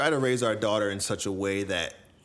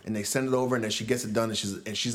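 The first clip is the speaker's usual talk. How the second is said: ordinary delivery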